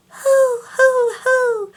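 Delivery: high thin falsetto hoo; airy high hoo. Shown as a demonstration.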